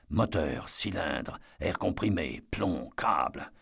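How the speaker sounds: a murmured checklist to himself; quiet inward recital. A narrator's reading. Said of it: "se passer en revue les entrailles".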